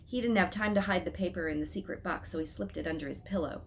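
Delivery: ordinary delivery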